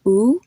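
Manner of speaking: "oo" as a long double-O vowel, rising; vowel demo. Shown as a demonstration. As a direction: say "oo" like the vowel in fool. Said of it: "pronounce a double O".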